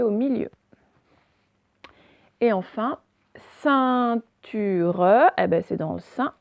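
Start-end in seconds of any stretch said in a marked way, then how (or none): 3.4-5.3 "ceinture" drawn out, syllable by syllable; teacherly, separated syllables in "ceinture"; syllable demonstration. Shown as a demonstration. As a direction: stretch "ceinture" so each syllable is heard. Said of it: "donc dans la première syllabe".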